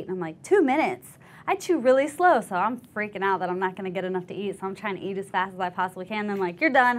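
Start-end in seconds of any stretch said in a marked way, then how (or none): 0.4-2.4 high, loud incredulous echo; startled recruit hearing the rule; shocked disbelief. Retold or imitated. Shocked retort to drill sergeant. 6.6-7.0 barked order. Retold or imitated. Drill sergeant barking order.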